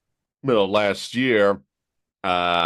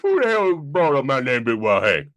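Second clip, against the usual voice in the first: Southern dad voice; 2 semitones higher than usual. Acted. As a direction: ham up an indignant Southern dad. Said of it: "doing this voice".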